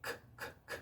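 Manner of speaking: isolated K clicks of pure air; very soft, separated puffs of K; voiceless K puffs. Shown as a demonstration. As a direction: release bare K sounds without any voice. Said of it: "en realidad es viento".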